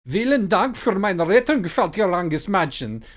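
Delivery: high, loud, grudging thanks in German; begrudging German. Acted. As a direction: thank her in German like it costs you. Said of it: "Brandy said begrudgingly".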